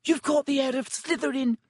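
high excited squeal. A narrator's reading. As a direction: squeal with delight. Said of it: "squealed a girl".

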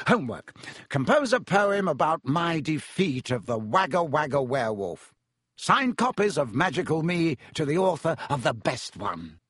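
grand, self-admiring announcement; boastful professor. Acted. Boastful professor sets homework about himself, grandly. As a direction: announce it like a showman teacher. Narrator acts out boastful Lockhart.

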